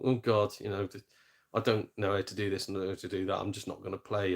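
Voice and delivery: low, soft, self-conscious mumbling; embarrassed. Retold or imitated. Gamer backing out of online play, embarrassed. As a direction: shrink back, voice dropped low and quiet. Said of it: "I sort of feel embarrassed".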